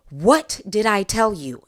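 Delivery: loud angry mom yell; mother yelling at her daughter about a crash. Retold or imitated. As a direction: snap at the kids loudly. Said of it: "I'm raising my voice and I'm yelling".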